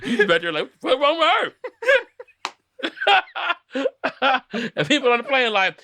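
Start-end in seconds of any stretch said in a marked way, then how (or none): 0.8-4.6 high confused question broken by laughter; laughing bafflement. Pretend. Guy on the plane wondering about the girl, high laughing voice. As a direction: ask it while cracking up.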